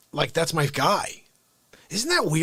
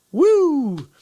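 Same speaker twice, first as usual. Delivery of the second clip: excited whoop; 10 semitones higher, 5 dB louder than usual. Real, not acted. Cheer for a viewer.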